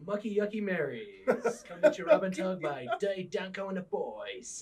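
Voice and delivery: loud, high sales pitch; hammed-up ad pitch. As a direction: shout it like a cheesy advert. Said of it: "They go".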